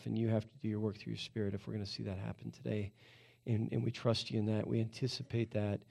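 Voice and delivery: soft, low prayer voice; hushed, low-pitched prayer in a conversational flow; spoken prayer. Real, not acted. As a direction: pray quietly and humbly to God.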